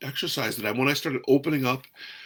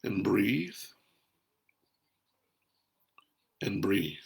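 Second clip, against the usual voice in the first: calm and soft; 4 semitones lower, 6 dB quieter, slower than usual. Shown as a demonstration. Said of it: "take a moment and breathe".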